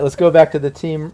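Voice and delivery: ordinary delivery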